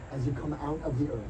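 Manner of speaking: ordinary delivery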